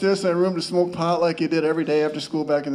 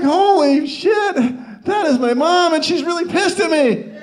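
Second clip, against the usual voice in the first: alarmed realization; 11 semitones higher, 4 dB louder than usual. Retold or imitated. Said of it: "I was like".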